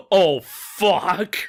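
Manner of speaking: mock dismayed reaction. Imitating the actor watching his own episode, amused dismay. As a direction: react as if just caught out. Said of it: "he's like".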